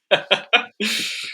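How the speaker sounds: a sudden high laugh that rises at the end. Real, not acted. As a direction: laugh up high at your friend's story. Laughing at the friend's story.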